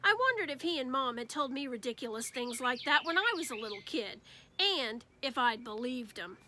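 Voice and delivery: ordinary delivery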